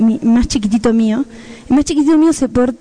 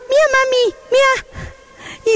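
put-on much higher, eager little child's voice; proud toddler; 12 semitones higher, faster than usual. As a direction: show mom your drawing like a proud two-year-old. Toddler showing his talc drawings.

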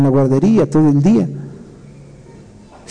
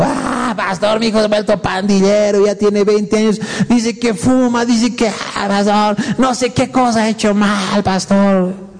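mock tearful wail, higher pitch; llanto fingido de madre; 5 semitones higher than usual. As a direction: wail to the pastor like a weeping mother, hammed-up. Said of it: "Luego vienen mamás llorando". (llanto exagerado y agudo)

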